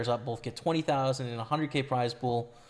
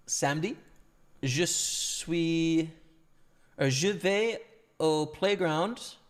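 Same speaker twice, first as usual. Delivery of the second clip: put-on slow, halting French with an English accent; foreign accent in French; 3 semitones higher, much slower than usual. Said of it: "mon français pas bon".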